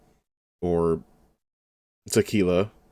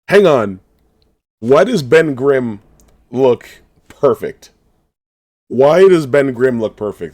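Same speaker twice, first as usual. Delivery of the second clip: loud, slow amazed questioning; excited disbelief; 4 semitones higher, 9 dB louder, slower than usual. Real, not acted. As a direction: drag it out in loud awe. Marveling at Thing design.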